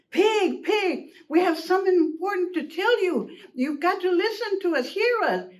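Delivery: high-pitched, insistent calling; urgent plea. Acted. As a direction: beg for attention with big news. Urgent call to Pig.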